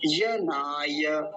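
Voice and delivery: यनाय very slowly as ya-naa-ya; no initial a. Shown as a demonstration. Teacher showing the correct form, drawn-out demo. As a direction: begin यनाय straight on y, long "naa". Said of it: "अयनाय नहीं बोलेंगे".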